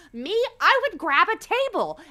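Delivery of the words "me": ordinary talk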